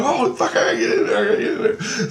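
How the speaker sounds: fast, high-pitched re-enacted urgency; excited eagerness. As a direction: act out itching to join the fight, laughing. Acts out eagerness to join battle.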